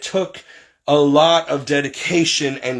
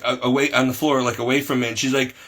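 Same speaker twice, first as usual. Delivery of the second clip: ordinary delivery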